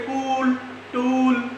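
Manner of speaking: कूल, टूल with a drawn-out oo; long vowel demo. Shown as a demonstration. Teacher gives examples of long double O, vowel held out. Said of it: "इसको लंबा पढ़ते हैं".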